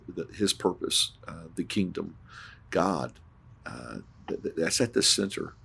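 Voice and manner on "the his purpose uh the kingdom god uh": usual speaking voice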